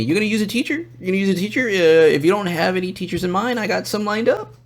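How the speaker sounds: high mock pestering; pestering reminder. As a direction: keep asking in a high, questioning lilt. Voices the nagging woman.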